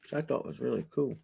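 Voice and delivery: usual speaking voice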